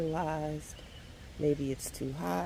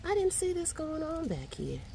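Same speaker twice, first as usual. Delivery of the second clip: very high-pitched surprise; amazed; 12 semitones higher than usual. Real, not acted. Gardener finding hidden beans in back, amazed. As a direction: start way up high in astonishment.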